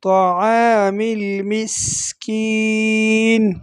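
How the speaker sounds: المسکین with a sharp hissing s, slow; slow recitation with hissed s; whistling sin. Shown as a demonstration. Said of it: "سیٹی کی آواز نکالنی ہے".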